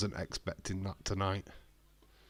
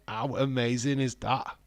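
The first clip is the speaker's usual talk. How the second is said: normal talking voice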